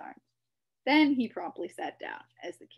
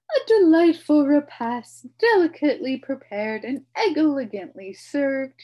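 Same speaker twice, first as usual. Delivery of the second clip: high, loud, grand humbug voice; pompous announcement; 7 semitones higher, 16 dB louder than usual. A narrator's reading. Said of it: "he announced to no one".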